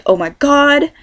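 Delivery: high-pitched mock Valley girl "oh my god". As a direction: imitate the Californian cliché, voice up. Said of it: "A lot of people think we say".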